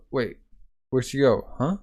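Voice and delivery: ordinary talk, nothing marked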